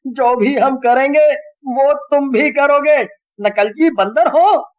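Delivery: high, loud exasperated rant; angry scolding. A narrator's reading. Rohan scolding the two friends for dragging him in. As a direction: tell them off, fed up.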